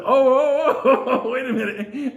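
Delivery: high, loud, laughing "oh, oh, wait a minute"; startled dodge. Retold or imitated. Priest caught off guard, startled laughing yelps.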